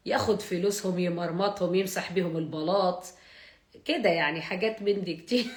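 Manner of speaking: lightly put-on Egyptian Arabic, fast at first; Egyptian dialect. Asked by the chat to talk Egyptian, a light Egyptian accent. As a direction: play at sounding Cairene, half joking. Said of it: "أحكي معانا بالمصري".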